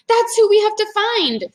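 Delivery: put-on high, sure little girl voice; Annie's voice. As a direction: declare it as eager Annie. Annie voiced in read-aloud.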